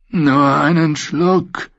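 slow, high pleading voice; a slow, high beggar's call from the cage. A narrator's reading. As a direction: drag it out, begging for one sip. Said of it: "rief der Vetter herunter".

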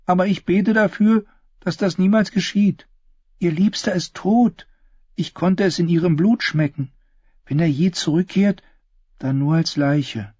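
Yna's foreboding, pitched high; high character voice, sinking toward the grim ending; einäugige Yna. Acted. Voices Yna's dark prophecy. (hohe, düster mahnende Figurenstimme)

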